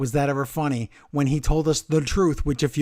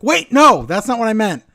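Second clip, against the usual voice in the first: high, loud sudden protest; retold flustered backtrack; 6 semitones higher, 7 dB louder than usual. Retelling a wrestler caught out in the gimmick, flustered. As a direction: blurt out a hasty retraction. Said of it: "he's like".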